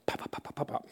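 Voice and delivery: soft, voiceless mouth pops; rapid popping noise. Retold or imitated. Passenger keeps hitting the call button, soft rapid pops. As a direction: imitate a button being hit repeatedly.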